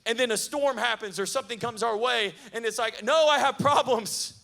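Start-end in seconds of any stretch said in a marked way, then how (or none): 3.0-4.3 loud, higher whining protest; mock panicked cry. Preacher voicing a shaken believer, loud mock whine. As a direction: whine it out like faith just collapsed.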